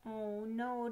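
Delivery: usual speaking voice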